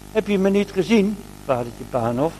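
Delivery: usual speaking voice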